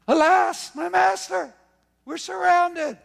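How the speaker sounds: high, loud frightened wail; panicked cry. Acted. Servant crying to his master, high and frightened. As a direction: cry out in terror at the chariots.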